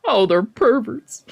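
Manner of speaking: ordinary talk, nothing marked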